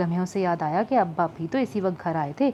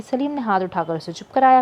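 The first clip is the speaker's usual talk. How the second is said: ordinary delivery, nothing marked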